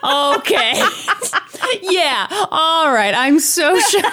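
talking through laughter; giggling while answering; laughing. Real, not acted. Laughing at co-host's joke.

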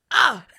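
short loud yell as he throws; effort grunt. Pretend. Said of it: "What if he yelled when he threw it?".

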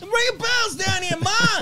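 put-on high, loud kid shout; whiny, high shout up to Ma; shouting up to mom. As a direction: yell up from the basement at your mother.

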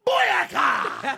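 very high, loud imitation of a hype entrance; exaggerated reggae battle cry; mock dancehall shout. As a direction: blast it out, mocking his Jamaican chant. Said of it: "Pat came in".

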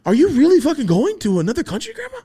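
high, loud disbelief; shocked high question to grandma; incredulous. Retold or imitated.

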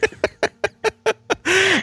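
a real laugh, pitched high and airy; amused high giggle. Real, not acted. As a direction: burst out laughing at the silly idea. Laughing at her own joke.

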